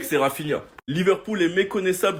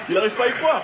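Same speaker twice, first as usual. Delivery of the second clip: high, giddy laughing voice; laughing in disbelief; 7 semitones higher, faster than usual. Real, not acted. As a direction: laugh through the words in delight. Narrating friend's joyous reaction.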